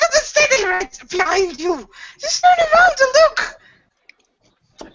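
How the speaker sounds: high, slow, desperate plea; high frightened plea; frightened pleading. Acted. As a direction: beg her to turn and look. Frightened insistence about the wolf.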